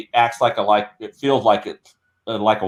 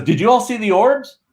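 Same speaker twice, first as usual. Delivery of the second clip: lightly acted, higher-pitched quote; mild mock astonishment, pitched up; retold exclamation; 8 semitones higher than usual. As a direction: quote him with raised pitch and a touch of amazement. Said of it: "he goes".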